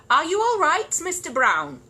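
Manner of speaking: high, loud call through the box. Acted. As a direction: call out to someone shut inside. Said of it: "knocked on the box and called out".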